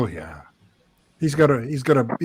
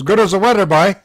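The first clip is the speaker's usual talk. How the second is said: louder, higher mock Newfoundland accent; imitated Newfoundland accent; 4 semitones higher, 6 dB louder than usual. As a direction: answer like a Newfoundlander, retelling a greeting. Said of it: "And he goes".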